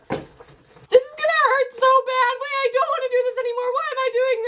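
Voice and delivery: high-pitched wail of dread; panicked whining. Real, not acted. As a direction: whine high, dreading the pain.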